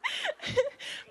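high burst of real laughter. Real, not acted. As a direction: crack up mid-story. Amused at her own scary story.

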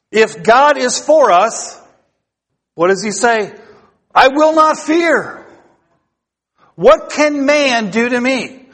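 slow, high, declaiming the verse; rising preaching fervor. Acted. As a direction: lift every word toward the rafters. Preaching climax.

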